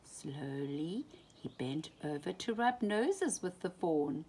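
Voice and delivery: ordinary delivery, nothing marked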